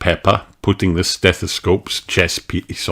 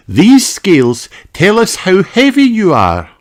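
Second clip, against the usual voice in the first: high, friendly doctor; kindly doctor greeting a child; Doctor Polar Bear voice; 14 semitones higher, 6 dB louder than usual. Acted.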